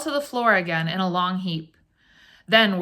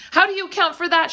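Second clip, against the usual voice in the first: high, fast, louder outcry; alarmed cry; 10 semitones higher, 5 dB louder, faster than usual. A narrator's reading. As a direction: cry out near hysterics. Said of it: "she cried".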